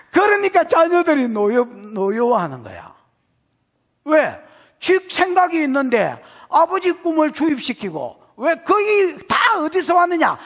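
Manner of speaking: high, loud fiery sermon delivery; full-force preaching. Acted. Pastor rousing the congregation about angry parents, fiery preaching.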